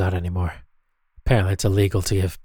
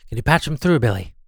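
ordinary talk, nothing marked

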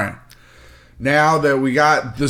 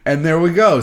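ordinary talk

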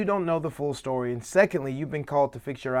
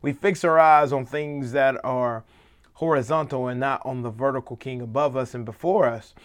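ordinary talk, nothing marked

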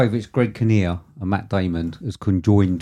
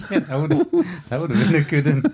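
higher, breaking into real laughter; laughing; 6 semitones higher than usual. Real, not acted. Host picturing a parrot in the film, laughing. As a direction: talk through the giggles.

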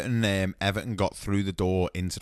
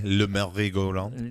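mock French. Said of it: "that's how you say that".

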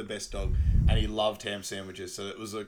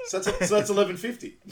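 laughing; 10 semitones higher, 6 dB louder than usual. Retold or imitated. Character repeats bill while laughing.

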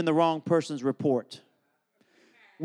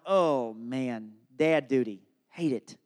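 glum dread; 7 semitones lower, 4 dB quieter, slower than usual. Retold or imitated. Dad dreads telling his daughter.

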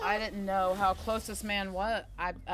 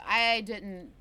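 ordinary delivery

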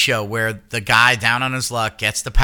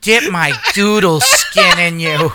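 louder sultry diva drawl; Coolidge impression; 3 semitones higher, 4 dB louder than usual. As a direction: purr it like the diva. Keeps doing the impression.

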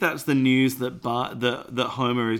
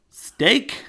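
high, loud questioning squawk; salesman voice; 9 semitones higher, 5 dB louder than usual. Acted. Co-host reading the shop guy's reply, high put-on salesman voice. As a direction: pitch it way up as a comic query. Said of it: "And then this guy says".

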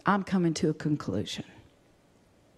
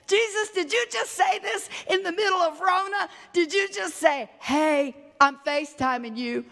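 high, excited preacher's shout; impassioned preaching; 11 semitones higher than usual. Acted. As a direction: exclaim it in amazed delight to the Lord. Preacher exclaims to Jesus at full force.

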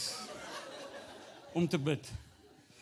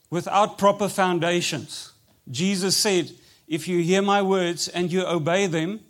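louder, driving sermon delivery; preaching; 11 dB louder than usual. Acted. Pastor preaching to the church, louder full-force preaching. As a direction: preach it out to the congregation.

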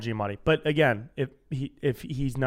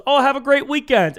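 cheery driver farewell; 10 semitones higher, 6 dB louder than usual. Retold or imitated. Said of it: "And the guy goes".

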